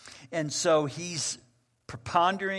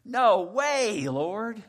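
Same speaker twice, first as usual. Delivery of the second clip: high, loud burst of disbelief; shocked refusal; 7 semitones higher, 5 dB louder, much slower than usual. Acted. Peter balks at God's command, high and loud. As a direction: recoil from the idea. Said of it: "this is what Peter says".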